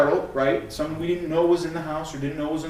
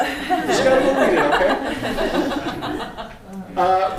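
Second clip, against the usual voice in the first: laughing; 6 semitones higher, 6 dB louder than usual. Real, not acted. Laughing at daughter's reaction.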